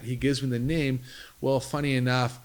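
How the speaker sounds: normal talking voice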